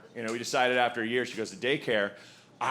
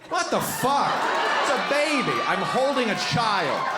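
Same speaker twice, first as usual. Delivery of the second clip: higher, louder appalled outburst; outraged shout; 8 semitones higher, 4 dB louder than usual. Acted. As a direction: cry out in disbelief at her. Outraged reaction to wife.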